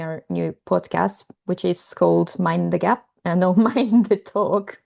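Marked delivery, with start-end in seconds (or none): none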